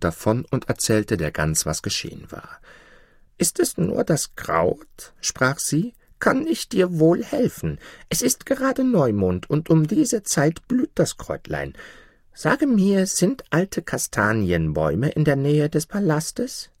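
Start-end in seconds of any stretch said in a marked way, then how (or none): 3.4-5.1 slow, high goose question; helpful goose. A narrator's reading. Goose questions the weeping dwarf, helpful. 6.2-16.7 high-pitched, eager goose voice; helpful goose. A narrator's reading. Goose offers the weeping dwarf help, helpful.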